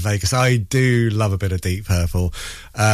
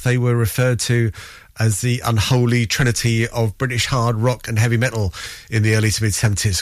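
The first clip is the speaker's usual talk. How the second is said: ordinary talk, nothing marked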